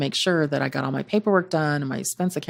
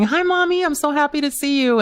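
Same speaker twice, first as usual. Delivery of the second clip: high, louder imitation of a delighted kid; happy child greeting; 11 semitones higher, 4 dB louder than usual. Young daughter greeting her traveling mom at the game, imitated high and happy. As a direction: voice a thrilled little kid's hello.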